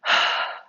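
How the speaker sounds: loud breathy sigh out; dramatic sigh. Shown as a demonstration. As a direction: push the air out in a big sigh. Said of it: "Like if you took a big sigh and went".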